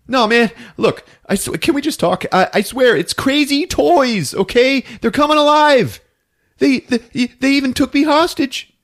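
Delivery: high, stammering, insistent plea; frantic pleading. Retold or imitated. Ralph pleads with attacking David.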